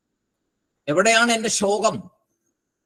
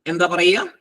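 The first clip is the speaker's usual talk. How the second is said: ordinary delivery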